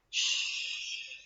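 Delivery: soft whispered shh; whispered shush. A narrator's reading. Whitney hiding in the tail, whispering shush. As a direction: hush so the dragon sleeps on. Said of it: "She had to be very quiet".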